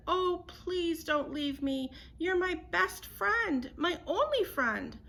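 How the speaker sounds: a small bear begging his friend not to go; crying plea. Acted. Little bear begs snow bear to stay, tearful and pleading. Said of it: "The little bear cried and cried and said".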